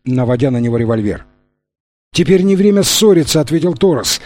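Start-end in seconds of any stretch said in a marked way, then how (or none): 2.1-3.4 raised, urgent calling voice; urgent shout. A narrator's reading. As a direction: call out in alarm from the water. Torres pleads.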